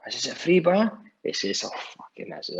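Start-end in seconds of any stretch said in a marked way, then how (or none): 0.3-1.0 high, rising disbelieving question; a hammed-up double take; mock surprise. 1.6-2.4 whispered curse; retold whisper. Retold friend's reaction.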